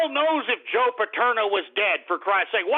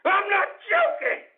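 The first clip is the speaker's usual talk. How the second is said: high, strained angry shout; furious insistence; 9 semitones higher than usual. Real, not acted. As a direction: yell it, voice shooting up. Yells the insistence a second time.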